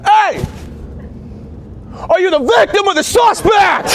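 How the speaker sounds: high shouted cop command. Retold or imitated. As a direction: bark it like a rookie cop. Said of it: "I go".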